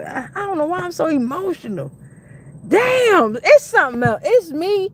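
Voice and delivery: high, overwhelmed outburst; emotional, near tears. Real, not acted. Overcome after singing to Kai, about to cry. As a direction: voice climbs as tears threaten. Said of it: "I'm about to cry".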